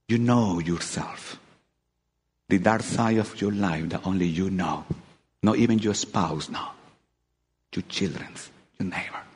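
preacher confiding to the congregation, hushed and low. Real, not acted. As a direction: lean in and drop to a near hush. Preacher drops to intimate hush.

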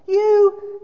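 very high, loud YOU; outraged gasp. A narrator's reading. Ghost answering the spirit's accusation, outraged gasp. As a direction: burst out in shocked indignation. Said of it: "gasps the ghost".

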